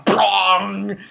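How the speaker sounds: loud, ringing impact noise; big drawn-out crash effect; imitated crash. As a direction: boom out a cartoon collision sound. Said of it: "and he goes".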